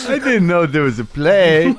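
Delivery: slow, high-pitched meathead voice; mock dumb jock. Jock explaining himself to debate teacher, mock dim voice. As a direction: play a slow-witted, baffled jock.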